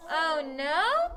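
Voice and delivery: "Oh no" with rising, asking tune; question. Shown as a demonstration. Teacher repeats for the class, questioning rise. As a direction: make "no" swing up like a query. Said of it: "it seems like I'm asking".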